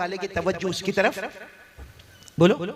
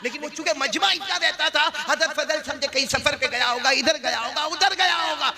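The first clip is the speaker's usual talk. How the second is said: high, impassioned pulpit delivery; full-force preaching; 10 semitones higher than usual. Real, not acted. Preacher telling congregation of a worried teacher, impassioned. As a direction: build in pitch through the list.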